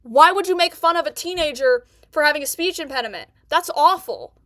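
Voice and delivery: ordinary talk, nothing marked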